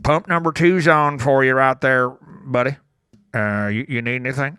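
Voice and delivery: slow Southern drawl pointing to a pump. Retold or imitated. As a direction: drawl it like a friendly gas station clerk. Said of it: "slow Southern drawl".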